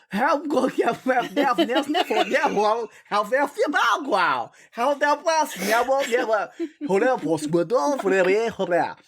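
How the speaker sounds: French Simlish. Pretend. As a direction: babble like a French Sim. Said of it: "I'm like a French sim".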